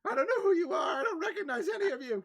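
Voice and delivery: high, loud and fast, near-hysterical; frightened old lady. Acted. Confused old lady denies knowing the party, panicked. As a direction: rattle it off in a panic.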